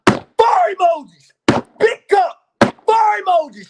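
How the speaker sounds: high, loud, slow hyped yell; mock yelling out. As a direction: holler like a fan in the chat. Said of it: "I'm gonna get to yelling out".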